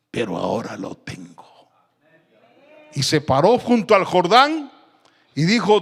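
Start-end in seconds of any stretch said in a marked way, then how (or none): 0.1-1.7 low, hushed whisper; susurro contenido. Acted. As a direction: whisper it as the disciple finally holding the mantle. Eliseo imaginado con el manto. (susurro grave y triunfal)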